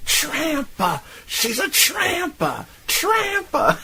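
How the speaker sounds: very high-pitched imitation of a woman yelling; mock yelling. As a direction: shriek the insult in mock outrage, no real volume. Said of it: "but she's yelling".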